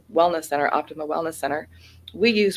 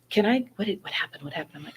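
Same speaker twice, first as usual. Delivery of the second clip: lightly voiced prying wife, dropping softer; hushed, repeated prying questions; nosy wife; 3 semitones higher, 3 dB quieter than usual. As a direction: imitate a wife angling for details, voice falling away. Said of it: "and the wife".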